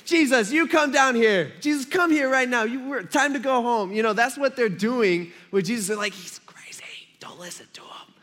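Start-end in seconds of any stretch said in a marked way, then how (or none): 0.1-3.9 loud, high-pitched family summons; mock scolding call. Acts family ordering Jesus home. 6.1-8.1 secretive stage whisper to bystanders; whispered mock warning. Acts family warning onlookers secretly.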